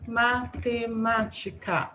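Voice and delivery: "matemática" slowly as ma-te-má-ti-ca; "matemática" in separate, measured syllables. Shown as a demonstration. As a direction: sound out each syllable of "matemática". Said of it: "vamos contar as sílabas".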